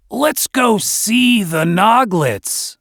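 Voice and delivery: slow, loaded, meaningful tone; heavy innuendo. A narrator's reading. Dwarf signalling his friend to escape, slow and loaded. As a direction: say it so only your friend gets the hint. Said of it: "he spoke with innuendo heavy in his tone".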